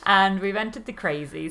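normal talking voice

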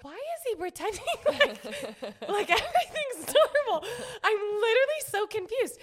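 high, loud panic breaking into laughter; hammed-up frantic disbelief, half laughing; retold terror. Woman hiding under covers at night, comic terrified wail. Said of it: "Can you not hear the terror in my voice?".

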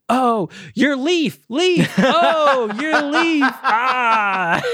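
high, drawn-out imitation of dawning realization; hammed-up high "oh, it's you!" reaction; mock sudden recognition. Acts out Carl's sudden delighted recognition.

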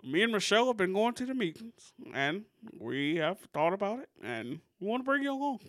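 measured presidential impression, phrases lilting upward; Barack Obama. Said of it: "That was a pretty good Obama impression".

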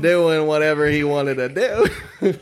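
amused high-pitched brag; gleeful boasting. Real, not acted. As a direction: tell it with relish, laughing at yourself. Gleefully telling his wild-phase alter ego.

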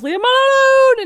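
high, loud, drawn-out mimicked yell; yelling in accent. Imitating film mom on the phone, accented yell. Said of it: "she just yells".

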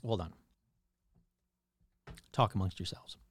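ordinary talk, nothing marked

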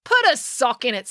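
mildly voiced snap to shut them up; snapped. A narrator's reading. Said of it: "snapped April".

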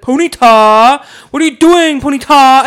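loud, drawn-out farmer calling his horse. Retold or imitated. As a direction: call the horse name out, stretched and loud. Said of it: "he's like".

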